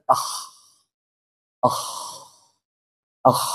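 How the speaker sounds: "Ah" closed by a long voiceless throat rasp, akh; kho with sukun. Shown as a demonstration. Teacher checking the letter by stopping on it, rasped akh. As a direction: let "Ah" run out into kh friction.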